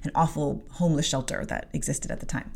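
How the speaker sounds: usual speaking voice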